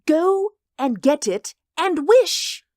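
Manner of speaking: slow, very high, loud command; quivering excitement. A narrator's reading. Wife commanding her husband, quivering with excitement. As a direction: drive each phrase home excitedly. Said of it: "quivering with excitement".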